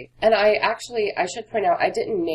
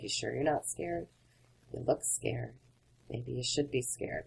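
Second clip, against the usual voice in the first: a much softer, taunting murmur; menacing taunt; 4 semitones lower, 12 dB quieter than usual. Retold or imitated. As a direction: needle her quietly like a creepy stranger at a bar. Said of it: "And he's like".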